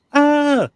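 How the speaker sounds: long, high, loud "aah"; mock scream. Panelist casting a vote for the monster cartoon, mock scream. Exclaiming the show title's opening cry.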